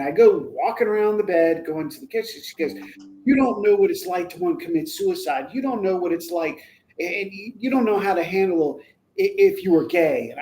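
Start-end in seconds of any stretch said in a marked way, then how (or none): 3.2-10.3 higher-pitched imitation of an upset teen; retold angry outburst. Upset teen lashing out at father, angry. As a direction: protest as a misunderstood teen, mildly heated. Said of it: "starts getting this real angry issue".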